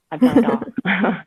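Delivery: playful boast. Real, not acted. Bragging about home improvement skills.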